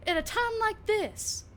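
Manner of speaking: a furious clinic owner tearing into her husband; indignant rant. Acted. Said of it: "she goes".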